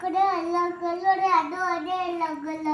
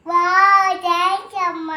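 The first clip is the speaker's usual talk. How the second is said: excited singsong; 12 dB louder than usual. Real, not acted. Child delighted by praise.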